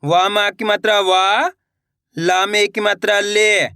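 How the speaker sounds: put-on slow, measured syllable-by-syllable reading for kids to repeat; slow spelling drill. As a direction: spell each word out patiently, pausing for the class. Teacher spells words syllable by syllable. (धीमे धीमे मात्रा जोड़कर पढ़ाना)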